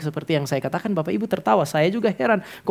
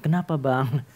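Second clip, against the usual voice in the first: "Kenapa Bang?" low and soft, timid; meek reply; 5 semitones lower, 6 dB quieter, slower than usual. Retold or imitated. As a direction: ask it shyly like the quiet friend. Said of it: "Kalau ini enggak". ("Kenapa Bang?" pelan dan malu-malu)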